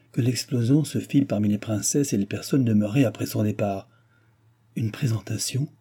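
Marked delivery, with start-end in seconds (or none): none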